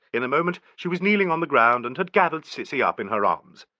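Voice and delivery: ordinary delivery, nothing marked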